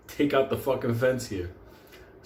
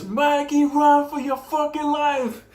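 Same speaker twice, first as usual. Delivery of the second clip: high, drawn-out panicked yell; shouted warning; 13 semitones higher, 5 dB louder, slower than usual. Retold or imitated. Two friends warn their buddy of the loose dog, acted frantic shout. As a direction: yell it across the yard, stretching the words. Yelling warning across the fence.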